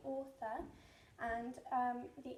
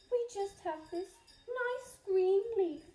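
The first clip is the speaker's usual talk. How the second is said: timid ants; 4 semitones higher, 10 dB louder, slower than usual. Acted. Ants answer.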